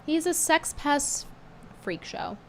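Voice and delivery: ordinary delivery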